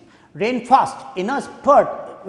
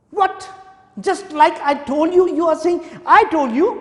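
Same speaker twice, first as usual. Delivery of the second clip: very high, loud incredulous shout; outraged disbelief; 8 semitones higher, 3 dB louder than usual. Acted. Governor shouting in disbelief at the officer. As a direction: explode in disbelief.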